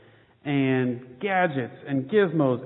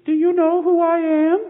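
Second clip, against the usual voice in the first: joyful mother; 12 semitones higher, 7 dB louder than usual. Acted. Mother bird returns to baby.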